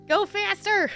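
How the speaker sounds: high, loud playful order; loud, exasperated mock scolding; mock impatient command. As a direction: pretend to yell at a slowpoke to hurry up. Mock-scolding a slow game character.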